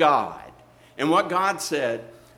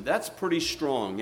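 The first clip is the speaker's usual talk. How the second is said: ordinary talk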